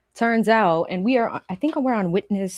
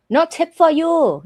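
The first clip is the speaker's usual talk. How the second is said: louder, punchy no-tip catchphrase; mock snub; 5 semitones higher, 6 dB louder than usual. Streamer teasing chat, louder and punchy. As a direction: snap it out as a joke. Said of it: "catchphrase callback".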